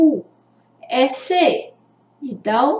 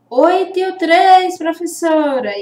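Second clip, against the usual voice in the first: higher, louder voice of an eager class; pupil answering teacher; 5 semitones higher, 4 dB louder than usual. Acted. (aluno respondendo à professora, voz mais aguda)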